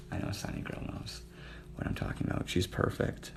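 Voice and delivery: soft half-whisper; softly whispered aside. Real, not acted. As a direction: drop to a hush. Sultry talk to viewer.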